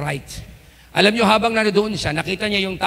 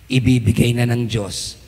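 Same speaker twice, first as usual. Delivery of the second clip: preaching call; 6 semitones lower, 3 dB louder than usual. Acted.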